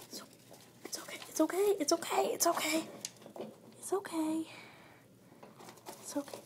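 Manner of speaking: calm a frightened little pet; soothing whisper. Real, not acted. Whispering to her nervous hamster to soothe it.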